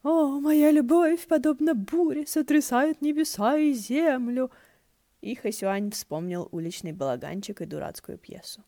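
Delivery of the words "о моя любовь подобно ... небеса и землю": high, slow, wailing theatrical lover; mournful moan. A narrator's reading. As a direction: moan it like a ham stage actor. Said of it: "заунывный голос в голове простонал". (заунывный театральный стон, высоко и медленно)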